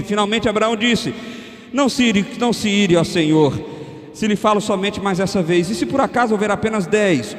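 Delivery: loud, high preached reading of the Bible text; fervent scripture reading. Acted. Preacher reading Abraham's plea to the congregation, loud and fervent. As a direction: proclaim the verses to the church.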